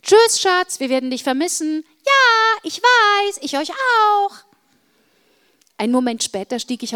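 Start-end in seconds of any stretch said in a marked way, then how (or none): 0.0-1.8 put-on loud, high farewell to their child; raised-voice parental send-off; parents calling goodbye. Parents call goodbye back. 2.1-4.3 high, loud shouted reply; drawn-out, full-voiced goodbye shout; calling back. Child shouts back to parents.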